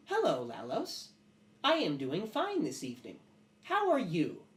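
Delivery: high, swooping artificial voice; sentient metal creature. Acted. Metal face answering its master, oddly polite. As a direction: speak as a polite metal being, pitch sliding up. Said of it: "and it goes".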